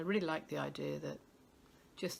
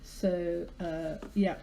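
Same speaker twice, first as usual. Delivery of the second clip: ordinary delivery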